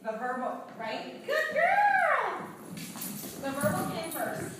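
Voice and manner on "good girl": much higher, louder praise; squeaky dog praise; high happy praise. Real, not acted. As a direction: gush at the dog.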